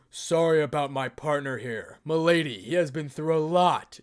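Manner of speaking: loud, booming dragon companion. Acted. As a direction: speak up like a proud dragon. Drake speaks through Issei's hand.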